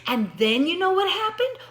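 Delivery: loud and high, rising on happened; loud excited storytelling. Shown as a demonstration. Teacher showing loud contrast to student, loud and excited. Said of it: "see how I got loud and soft".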